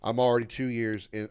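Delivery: ordinary talk, nothing marked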